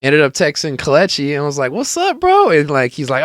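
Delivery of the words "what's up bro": very high, animated hail; retold hearty greeting. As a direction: toss out an exaggerated high hello. Acting greeting to friend.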